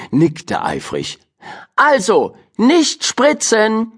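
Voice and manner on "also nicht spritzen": high, slow emphatic rule; stern teacher. A narrator's reading. Teacher warning Jo and Juri, high and stern. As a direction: lay down the law, stressing nicht. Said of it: "einen strengen Blick zu".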